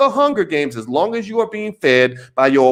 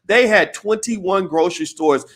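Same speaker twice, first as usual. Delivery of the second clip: normal talking voice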